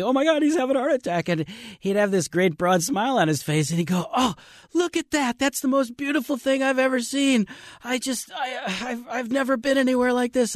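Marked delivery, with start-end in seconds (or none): 0.0-1.3 high, quick panicked thought; mock alarm. Guide voicing boatmen's fear for the old rafter, mock alarm. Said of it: "you'd kinda turn around thinking". 4.2-10.5 very high, overcome with amazement; imitated awestruck rafter. Guide imitating a man in his eighties seeing scenery, awestruck. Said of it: "he'd just scream".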